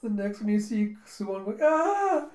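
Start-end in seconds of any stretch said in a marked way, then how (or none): 1.6-2.3 high, loud drawn-out "aah"; mock scream. Fleeing king before his enemy, a high mock scream. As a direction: shriek in mock fright.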